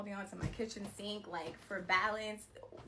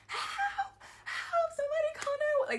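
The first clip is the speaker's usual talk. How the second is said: very high, fast frantic scream; screaming for help; 8 dB louder than usual. Retold or imitated. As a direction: shriek like your life depends on it. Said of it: "I've never screamed like that in my life".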